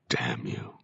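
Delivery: whispered, soft curse; muttered curse. A narrator's reading. A ghost cursing his effigy, whispered. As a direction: breathe the curse under your breath. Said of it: "I muttered".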